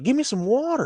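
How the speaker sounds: high squeaky panicked plea; mock frantic high plea; panicked friend. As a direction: beg for water in a frantic high voice. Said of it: "he's like, he's like".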